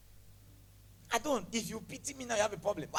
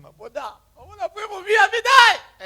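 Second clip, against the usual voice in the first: high wail building to a loud cry; imitated desperate plea, shouted at the end; mock desperation; 8 semitones higher, 13 dB louder than usual. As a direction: plead in a hammed-up panic, voice climbing. Mimics her old desperate plea.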